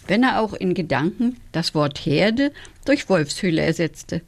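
usual speaking voice

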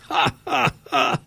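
hohes Waldschrat-Gelächter; hoch und lustig lachen; lachend. Acted. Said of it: "lachten die Waldis". (hohes „Ha, ha, ha“ der Waldis)

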